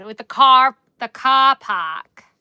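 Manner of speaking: loud, slow Boston car with the r dropped; overdone Boston accent. Shown as a demonstration. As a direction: lean into a Boston drawl. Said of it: "Boston is hard".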